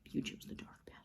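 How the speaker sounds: breathy whisper, lightly acted; whispered line. Bruce's last words to Slade, a whisper. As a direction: whisper the verdict.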